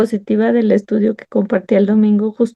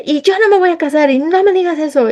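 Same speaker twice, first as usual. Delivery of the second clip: put-on defiant teen; 8 semitones higher than usual. Defiant teen self quoted.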